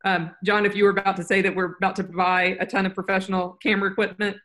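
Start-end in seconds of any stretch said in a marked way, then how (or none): none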